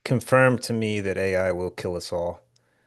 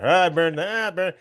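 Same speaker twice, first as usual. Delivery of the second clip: gleeful arsonist; 8 semitones higher than usual. Pretend. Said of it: "set 'em all on fire".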